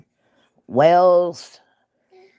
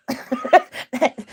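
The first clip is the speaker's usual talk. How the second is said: high laugh; 11 semitones higher than usual. Real, not acted. Laughing at church's confusion.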